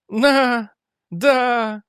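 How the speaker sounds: high, drawn-out, happy voice; blissful madman. A narrator's reading. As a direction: sound delighted by your own private thoughts. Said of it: "радостно улыбался собственным мыслям". (высоким голосом, в безумной радости)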